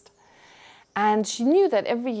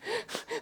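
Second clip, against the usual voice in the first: short high laugh; 10 dB quieter than usual. Real, not acted. Laughing at her own shock.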